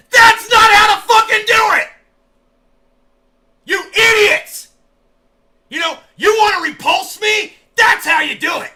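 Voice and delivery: very loud, high, furious yelling; in-character screaming. Acted. Streamer in character screaming at the show's makers, furious. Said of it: "I start screaming".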